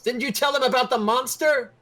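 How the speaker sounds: high, loud accusing question; indignant shouted comeback; shouted retort. Acted. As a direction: fire it back as a yelled challenge. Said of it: "the half-elf retorts".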